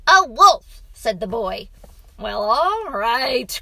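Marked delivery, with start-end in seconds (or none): 0.1-0.8 put-on high, very loud child voice; insistent boy. A narrator's reading. Boy rejects father's other animals, insistent. 2.2-3.6 slow, loud, reluctant groan. A narrator's reading. Father gives in to his son, groaning. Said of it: "groaned the man".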